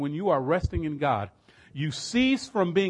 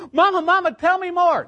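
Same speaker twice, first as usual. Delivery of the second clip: much higher, loud, pleading; eager high plea; 13 semitones higher, 10 dB louder than usual. Retold or imitated. Daughter begging her dying mother, loud and high.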